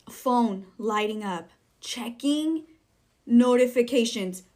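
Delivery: loud, high burst of outrage; exasperated rant. Real, not acted. Ranting about people talking during the movie, exasperated and loud. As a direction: rail at them, raising your voice.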